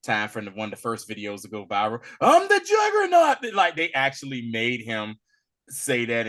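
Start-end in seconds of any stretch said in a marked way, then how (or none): 2.2-3.5 loud high mock shout of the famous line; imitating the Juggernaut's yell, much higher and louder. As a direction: belt it out like the viral clip. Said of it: "that little line that became very popular".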